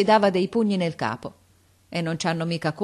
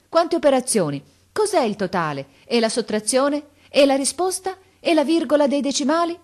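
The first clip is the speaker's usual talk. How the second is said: higher, louder rapid-fire parent questions; eager questioning; 8 semitones higher, 4 dB louder than usual. A narrator's reading. Parents crowding the kids after the exam, eager and louder.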